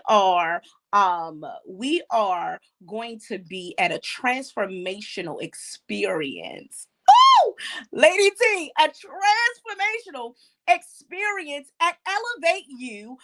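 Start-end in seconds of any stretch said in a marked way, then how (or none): 1.7-6.9 measured announcement. Real, not acted. First, measured announcement of the event. 7.4-12.7 high, louder, thrilled announcement; excited hype. Real, not acted. Host hypes the event to Lady T, excitedly.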